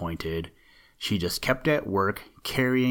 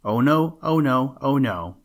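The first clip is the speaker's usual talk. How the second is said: higher, louder teasing refusal; playful teasing; 4 semitones higher, 4 dB louder than usual. Acted. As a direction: tease him, keep the secret. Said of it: "laughed together and chuckled".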